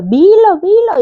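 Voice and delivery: rising, urging "Dilo, dilo"; mock insistent urging; insistent command. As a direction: push her to say it. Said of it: "pero me lo ordenaba".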